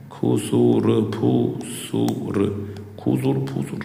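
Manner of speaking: soft, slow breathy whisper; softly whispered खुसुर फुसुर; whisper demo. Shown as a demonstration. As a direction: show a hushed whisper. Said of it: "whisper मतलब खुसुर फुसुर करना".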